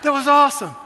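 put-on high, loud delighted boy; excited kid. Son after the roller coaster, loud and excited. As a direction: gush it like a thrilled child. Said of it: "he was so excited".

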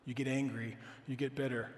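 ordinary talk

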